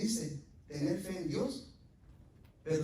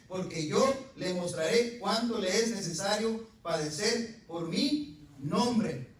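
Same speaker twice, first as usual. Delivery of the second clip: preached reading; 3 semitones higher, 6 dB louder than usual. Acted. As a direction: proclaim God's words, slowing at the end.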